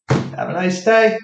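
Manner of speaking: high, sugary have a nice day; fake-pleasant brush-off; mock sweet goodbye.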